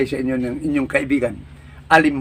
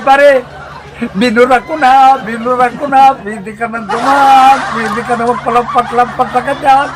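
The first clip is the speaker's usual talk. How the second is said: slow, louder, laughing through it; laughing friend; 6 semitones higher, 3 dB louder, slower than usual. Acted. Friend telling his buddy he erased his name, slow and laughing. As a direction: chuckle as you give in.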